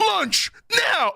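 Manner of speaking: high, shouted angry-wife call; hammed-up scolding Chi-Chi shout; mock Chi-Chi yell. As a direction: bark him in for lunch like a furious wife. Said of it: "Chi-Chi powers up".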